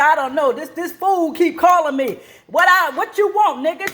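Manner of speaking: high, hammed-up angry mother yelling at caller; mock mother snapping.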